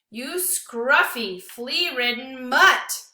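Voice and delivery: loud, slow, drawn-out furious insult; screaming insult. Acted. Lewis screaming abuse at the frightened dog, slow and loud. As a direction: spit each word slowly at the dog. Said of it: "screams Lewis".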